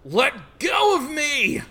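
high-pitched acted yell; struggling shout. Fighter grabbed by ghost, struggling shout. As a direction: yell while fighting off a grip.

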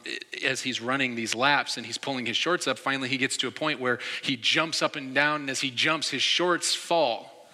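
ordinary delivery

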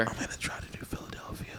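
whispered secret. Real, not acted. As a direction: whisper it so nobody hears. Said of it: "I don't wanna say it too loud".

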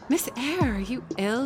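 worried maid. Acted. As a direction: ask after the child in alarm. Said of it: "said Bessie".

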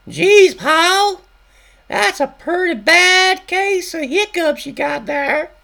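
very high, loud hillbilly wife voice; Louise's voice. Acted. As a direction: play the wife in a high falsetto. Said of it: "Now we got Louise speaking".

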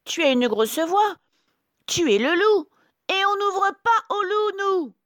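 put-on very high, loud little-kid voices; crying out. A narrator's reading. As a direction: shout defiantly as little goats. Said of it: "entendent la grosse voix et crient". (cris aigus des chevreaux)

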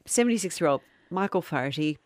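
the name with just a touch of Irish; barely Irish retelling. Acted. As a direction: try plain but let a little Irish slip. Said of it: "start again without being silly".

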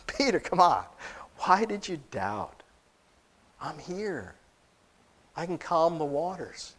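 soft, tender reassurance; smiling, gentle. Acted. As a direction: coax a doubting friend gently, voice dropping.

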